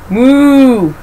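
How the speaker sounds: mooing like a cow. Acted. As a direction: give a big cow moo. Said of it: "said the cow".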